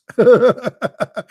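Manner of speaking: high burst of real laughter; loud, high real laugh. Real, not acted. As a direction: crack up at your own joke. Laughs at his own curse.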